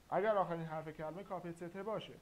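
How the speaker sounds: loud quick emphasis. Shown as a demonstration. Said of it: "teacher stresses the rule".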